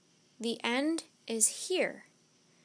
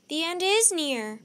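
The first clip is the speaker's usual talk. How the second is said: higher, louder ominous line; alarmed realisation; 5 semitones higher, 6 dB louder than usual. A narrator's reading. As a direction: say it as a dawning warning. Annie grasps the soothsayer's warning.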